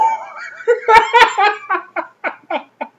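high-pitched cackling laugh; wheezing giggle fit; high laughter. Real, not acted. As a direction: lose it laughing. Laughing at his own bit.

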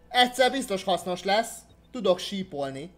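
usual speaking voice

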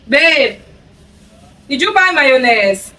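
loud, high call to husband. Real, not acted. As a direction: call across the house. Calling husband in another room.

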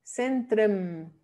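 centrem with weak "trem" ending; correct Dutch version. Shown as a demonstration. Teacher modelling "centrem", reduced Dutch ending. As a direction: say "centrem" with a short E-like last vowel.

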